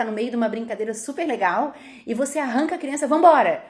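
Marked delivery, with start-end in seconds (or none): 3.1-3.6 a much louder barked order to go; loud command. Retold or imitated. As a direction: yank the child away with your voice. Pai arranca a criança. (ordem alta e brusca de ir embora)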